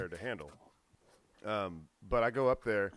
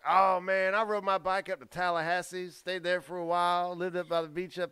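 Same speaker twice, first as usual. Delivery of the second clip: higher-pitched imitation of a rambling beach bum; drifter friend; 6 semitones higher than usual. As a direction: retell it as the laid-back wanderer, pitched up. Retelling Andy's travel tale in his voice.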